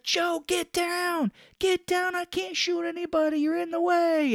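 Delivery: very high, strained pretend warning; mock yell. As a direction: holler a hammed-up warning over gunfire. Retold shout during gunfight.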